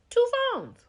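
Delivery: a gossip's scandalized outburst; shocked exclamation. Real, not acted. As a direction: yelp it in disbelief. Shocked reaction to two phones.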